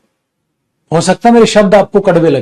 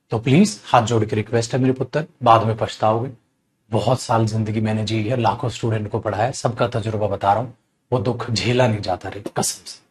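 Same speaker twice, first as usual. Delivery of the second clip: quiet plea; 6 semitones lower, 8 dB quieter than usual. Real, not acted. Teacher pleads with his students.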